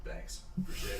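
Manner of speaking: whispered, sly thanks. Acted. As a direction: slip it out under your breath. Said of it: "And he's like".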